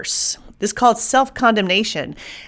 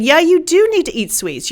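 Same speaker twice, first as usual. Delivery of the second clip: coaxing brain voice; 9 semitones higher, 3 dB louder than usual. Retold or imitated. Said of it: "Your brain is saying".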